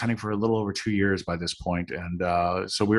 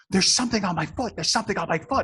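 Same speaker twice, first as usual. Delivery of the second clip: panicked; 10 semitones higher, faster than usual. Retold or imitated. Driver panicking in car.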